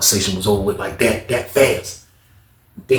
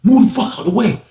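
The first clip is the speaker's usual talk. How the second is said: higher, forceful command to move; mock angry order; 9 semitones higher, 3 dB louder than usual. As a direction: bark it at him. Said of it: "Dude telling the boy".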